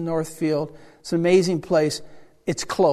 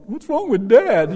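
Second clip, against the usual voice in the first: high, louder mock child voice; slow, high "what's wrong" whine; puzzled kids; 6 semitones higher, 7 dB louder, slower than usual. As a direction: imitate kids baffled by their father. Imitates his puzzled kids.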